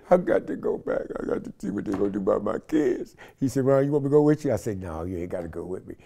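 mock tearful pleading, a put-on sob; half-hearted fake crying. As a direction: fake a cry to win him over. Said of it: "I did the fake cry on him".

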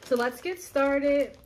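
hype host intro. Acted. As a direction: announce it slowly like a show host. Kicking off the challenge.

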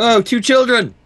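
sudden high, loud exclamation; mock alarm. Player spots two children running round the town, mock alarm. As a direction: cry out in high-pitched pretend alarm.

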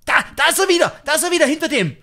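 high, loud alarmed calling; excited alarm. Real, not acted. Gamer spots the intruder again, excited shout. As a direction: point him out in a rush.

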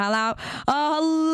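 usual speaking voice

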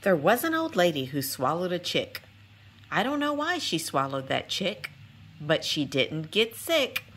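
lilting storybook read-aloud with singsong refrains; reading to children. Acted. Narrator reading a rhyming picture book to young viewers, lilting and gentle. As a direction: read it like bedtime story time for little ones.

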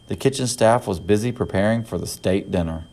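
plain reading voice

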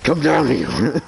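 loud, slow drawn-out yell; loud call. Real, not acted. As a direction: holler it up the stairs. Shouting friend down, drawn out.

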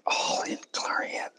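hushed, secretive whisper; soft stage whisper. Pretend. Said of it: "Why are we whispering?".

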